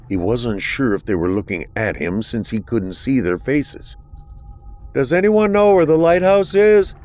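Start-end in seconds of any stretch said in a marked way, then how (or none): 4.9-6.9 loud and high, calling across the street. A narrator's reading. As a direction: call out to strangers. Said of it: "he called out".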